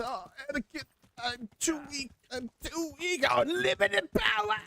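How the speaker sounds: high, hammed-up feeble Palpatine whine; mock a frail Emperor begging Anakin; Palpatine impression. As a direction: Star Wars villain faking weakness, high and whiny. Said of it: "full on Palpatine bullshit".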